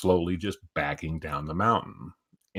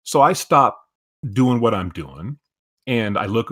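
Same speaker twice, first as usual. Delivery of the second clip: ordinary talk, nothing marked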